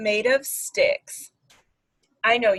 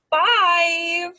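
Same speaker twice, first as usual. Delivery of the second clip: excited count; 8 semitones higher, 6 dB louder than usual. Acted. Counting the baby bees aloud.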